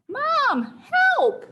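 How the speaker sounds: high-pitched cry for help; scared call. Retold or imitated. Scared child calls mom from tree, mock scared call. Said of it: "I felt scared".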